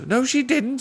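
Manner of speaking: high, cheeky squirrel retort; pert squirrel. Acted. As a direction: snap back cheekily like a squirrel. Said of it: "very pert".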